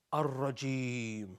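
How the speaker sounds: "ar-rajim" at half speed, long held "ee"; slow teaching pronunciation. Shown as a demonstration. Teacher teaching the phrase word by word, "ar-rajim" drawn out. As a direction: stretch the last syllable of "ar-rajim".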